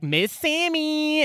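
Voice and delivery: high, slow, drawn-out "Miss Sammy"; mock Miss Sammy. Guard telling Aster to apologize to Miss Sammy, drawn out high. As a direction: sneer the name out high and slow. Guard says her name.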